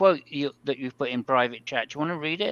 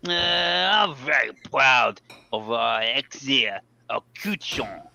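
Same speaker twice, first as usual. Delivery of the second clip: slow, hammed-up French accent reading a driver's quote; 4 dB louder, slower than usual. Reading the team quote aloud to co-hosts in a French accent. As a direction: put on your best French accent and take it slow. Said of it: "read it in your best French accent".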